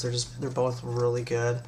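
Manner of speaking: ordinary talk, nothing marked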